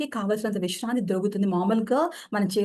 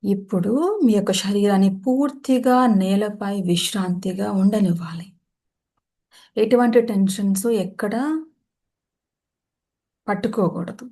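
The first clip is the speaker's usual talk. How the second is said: measured meditation voice with long pauses; guided meditation; 5 dB louder than usual. Acted. Yoga teacher settling listeners into shavasana, guided meditation voice. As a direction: guide them into rest, phrase by phrase.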